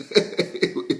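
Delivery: short giggling laugh; light, breathy laughter; helpless chuckle. Real, not acted. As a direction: chuckle as if nothing else can be done. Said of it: "I'm just laughing because".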